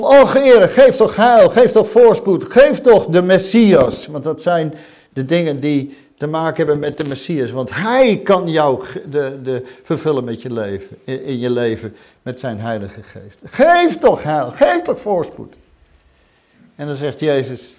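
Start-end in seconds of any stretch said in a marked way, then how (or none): 0.0-4.1 very loud, high pleading cry. Acted. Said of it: "ze hebben geroepen". 13.4-15.6 high, loud, slow begging; loud imploring prayer; cried-out plea. Acted.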